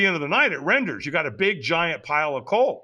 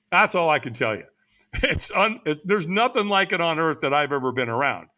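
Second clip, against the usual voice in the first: plain reading voice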